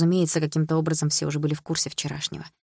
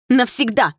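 loud, high outburst; angry; 8 semitones higher, 8 dB louder, slower than usual. A narrator's reading. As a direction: throw the word out in anger, voice raised. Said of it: "рассерженно выдохнула".

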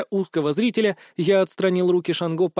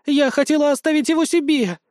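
high, frantic blurted cry; desperate outburst; 10 semitones higher, 4 dB louder than usual. A narrator's reading. Potion-maker blurts her secret claim, frantic. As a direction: blurt it out in high-pitched protest.

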